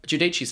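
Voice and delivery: "Giudici's" said joo-DEE-chee's; Italian-style pronunciation. Shown as a demonstration. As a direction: say "Giudici's" with an Italian -chee ending. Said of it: "I reckon it feels more Italian".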